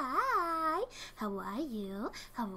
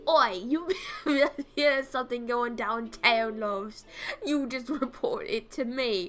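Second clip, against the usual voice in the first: hammed-up cockney private eye, louder; mock British accent; 6 dB louder, slower than usual. Streamer voicing a private investigator to the shop owner, in a mock cockney accent. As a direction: play a swaggering London gumshoe with relish. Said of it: "then it becomes European".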